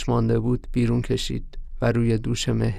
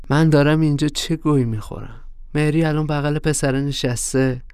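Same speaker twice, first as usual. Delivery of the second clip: ordinary delivery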